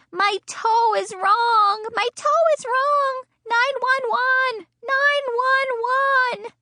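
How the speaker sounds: high, slow, loud crying yell. Acted. Girl yelling to Mother for help, high crying wail. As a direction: wail it like a panicked kid. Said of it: "I yelled".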